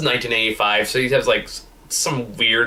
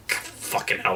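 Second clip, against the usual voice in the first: awed whisper; 3 semitones lower, 9 dB quieter, slower than usual. Real, not acted. Reacting to a great line.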